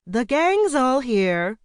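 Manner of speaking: high, drawn-out theatrical greeting; sing-song flamboyant older lady's arrival; grand entrance. A narrator's reading. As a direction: announce yourself loudly, stretching the words. Geraldine makes a theatrical entrance.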